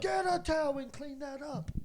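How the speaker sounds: high-pitched exasperated command; scolding order. Retold or imitated. Housemate telling him to fetch a towel, high scolding order.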